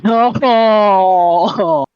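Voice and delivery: long, high wail of no; mock-mournful no. Real, not acted. Listener lamenting the sad story, loud mournful no. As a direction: cry out a loud, drawn-out no.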